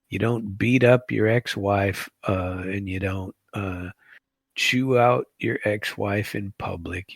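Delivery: ordinary talk, nothing marked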